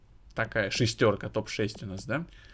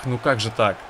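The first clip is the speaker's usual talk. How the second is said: ordinary delivery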